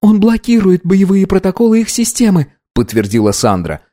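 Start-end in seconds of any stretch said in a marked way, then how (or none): 0.0-2.5 high female character voice; focused young woman. Acted. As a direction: report intently in a high voice.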